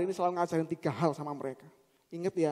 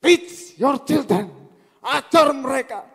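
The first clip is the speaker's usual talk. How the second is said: forceful preaching; 5 semitones higher, 11 dB louder than usual. Real, not acted. Preacher urging parents to teach their children, forceful shout. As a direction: drive each phrase home at full voice.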